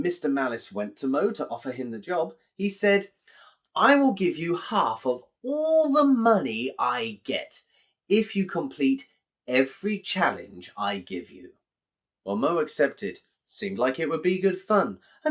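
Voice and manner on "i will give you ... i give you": lightly voiced sneaky bookmaker making a deal; sly bookmaker. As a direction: dangle the deal like a crafty con man. Malice offers Mo the job.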